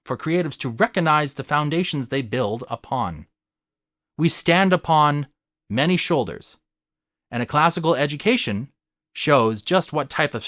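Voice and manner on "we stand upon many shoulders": usual speaking voice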